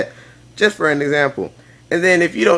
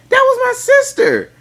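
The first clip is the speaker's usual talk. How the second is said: mock indignation; 5 dB louder than usual. Indignant reply to jealous girlfriend.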